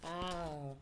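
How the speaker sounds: ordinary delivery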